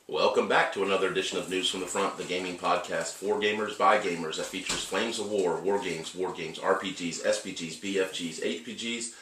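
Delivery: brisk radio host read; podcast host intro. Acted. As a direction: announce the show like a host.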